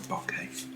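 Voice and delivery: bokeh as BOCK-ay; bokeh pronunciation variant. Shown as a demonstration. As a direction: open the first vowel of bokeh, "BAH-kay". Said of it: "how it's actually pronounced".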